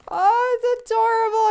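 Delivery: ordinary talk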